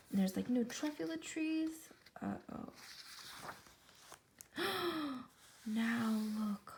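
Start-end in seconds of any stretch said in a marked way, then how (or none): 4.6-5.2 gasp. Real, not acted. Reader reacts to the story.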